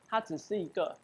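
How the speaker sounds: normal talking voice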